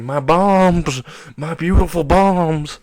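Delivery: high, loud lament; mock girlish wailing. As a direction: wail over what you have lost. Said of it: "screaming like a little girl".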